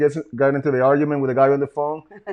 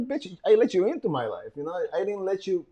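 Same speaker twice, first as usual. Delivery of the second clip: higher-pitched but softer comeback; retold retort; 5 semitones higher, 4 dB quieter than usual. Man answering his stalking ex, mildly retold retort. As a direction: throw her line back at her. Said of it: "And I'm like".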